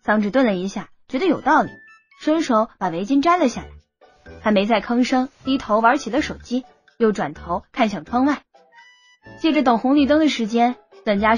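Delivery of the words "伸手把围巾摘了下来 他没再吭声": usual speaking voice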